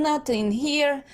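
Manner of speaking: usual speaking voice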